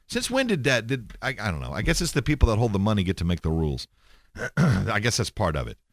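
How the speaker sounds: normal talking voice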